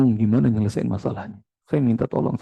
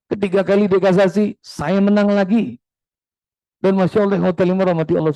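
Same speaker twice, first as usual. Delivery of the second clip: triumphant; 6 semitones higher than usual. Retold or imitated. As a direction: crow about winning again. Triumphant retelling of court wins.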